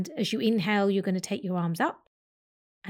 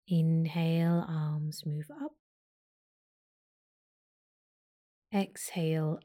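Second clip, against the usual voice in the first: guided meditation. Acted. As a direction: talk them through each breath gently.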